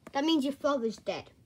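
ordinary delivery

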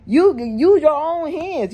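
high, slow, pressed scolding; exasperated lecture to Carmen about using her own hands; heated scolding. Acted. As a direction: drive the point home, exasperated. Scolding Carmen, rising heat.

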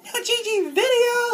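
very high, loud squeal through a laugh; excited shriek. Retold or imitated. Fan announcing Gigi's new video, squealing with laughter. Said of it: "I get so excited".

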